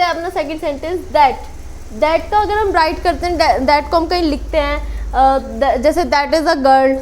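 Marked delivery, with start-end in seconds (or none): none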